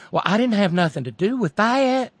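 high-pitched imitation Southern protest; mock Southern accent. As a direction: plead innocence in a put-on Southern accent. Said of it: "I do Southern".

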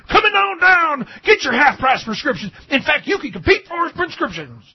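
high, loud hard-sell pitchman; used car salesman voice. Acted. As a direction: bellow it like a TV car ad. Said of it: "those used car salesman voices".

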